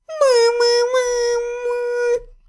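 high, muffled, smothered stammer; задыхаясь в подушке; muffled by pillow. A narrator's reading. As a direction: speak with face in a pillow.

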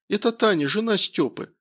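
lightly voiced woman calling by phone. A narrator's reading. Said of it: "послышался в телефоне женский голос".

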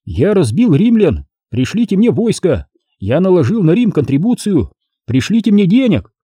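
high, rising proclamation; lightly declaimed quote. A narrator's reading. Boy quoting the general's dispatches, lightly declaiming.

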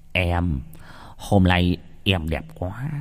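slow, soft leering come-on; low, oily compliment with a creepy chuckle before it; lecherous. A narrator's reading. Possessed young man flattering a girl, lecherous. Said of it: "mỉm cười khả ố".

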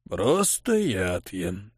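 low, slow creaking voice; creaky old man. A narrator's reading. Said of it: "проскрипел старик".

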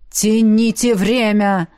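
slow, weighty telepathic order; urgent command. A narrator's reading. Alien urges her by mind to stall, slow command. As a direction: drag out each word, ordering them to stall. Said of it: "ворвался голос Альена".

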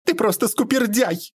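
very high, aggrieved reproach; shrill insult. A narrator's reading. Lady rebuking Bobrov after crying, high aggrieved reproach. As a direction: pitch the name-calling up high. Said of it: "выговорила дама".

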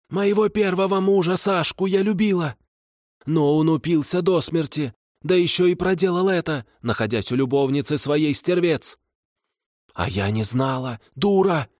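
high, complaining hag voice; aggrieved kikimora. A narrator's reading. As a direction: whine out her bitter tale. Kikimora voicing her grievances.

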